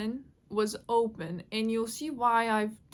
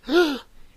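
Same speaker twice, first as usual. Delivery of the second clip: loud mock gasp; shocked gasp; 4 semitones higher, 9 dB louder than usual. Brother watching the fall, shocked gasp. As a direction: imitate an onlooker's sharp gasp. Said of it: "watching me like".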